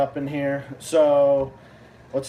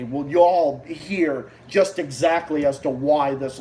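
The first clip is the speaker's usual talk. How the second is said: ordinary talk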